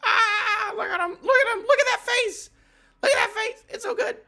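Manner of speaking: high, loud gushing squeal; squealing over Chopper. Real, not acted. Fan squealing at Chopper's cute face, high excited gush.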